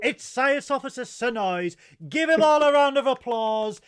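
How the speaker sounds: loud, high hype intro; game show host. Acted. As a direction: whip up the crowd. Hyped guest introduction.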